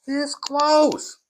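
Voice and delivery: loud, high, punched "This close"; emphatic cry. Real, not acted. Speaker warning how near disaster was, emphatic. Preacher drives the point home.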